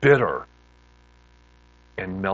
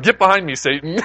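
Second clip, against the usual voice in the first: loud, high joking rebuke; pitched-up comic command; mock rebuke; 8 semitones higher, 10 dB louder than usual. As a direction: cast out the devil in playful alarm. Joking mock rebuke of changed friend.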